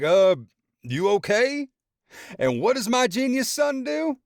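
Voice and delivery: roast the son while laughing; laughing, mocking dad. A narrator's reading. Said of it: "laughing even harder".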